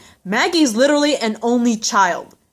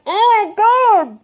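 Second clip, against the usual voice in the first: high, slow, louder gasping oh my God; overwhelmed gush; 11 semitones higher, 4 dB louder, slower than usual. Retold or imitated. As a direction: swoon over the scene. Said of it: "It's like".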